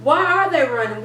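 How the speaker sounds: ordinary delivery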